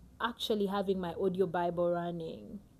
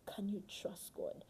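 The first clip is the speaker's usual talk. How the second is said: soft appeal; 12 dB quieter than usual. Real, not acted. Preacher appeals to listeners.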